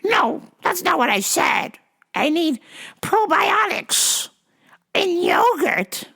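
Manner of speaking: high slow mock old lady demand; demanding old caller. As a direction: drag it out like a stubborn caller. Caller correcting him.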